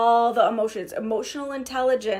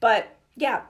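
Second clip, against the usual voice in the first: ordinary talk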